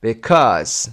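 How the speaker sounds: because with CAUSE punched and falling; light start, strong end in because; stress on second syllable. Shown as a demonstration. Teacher working through the exercise, second-syllable stress on because. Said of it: "second syllable".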